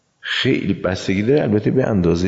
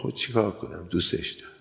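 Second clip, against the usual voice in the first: soft confession; 9 dB quieter than usual. Acted. Ant answers the prophet lovingly.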